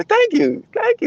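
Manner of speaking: very high, delighted "thank you" twice; high-pitched thanks. Retold or imitated. A guest thanking the African host in a delighted high voice. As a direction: squeak out a thrilled thank you. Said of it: "And you go".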